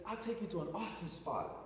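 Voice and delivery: a hint of Jamaican lilt for the driver; Jamaican accent. Taxi driver offering a ride, lightly Jamaican accent. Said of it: "He said in Jamaican".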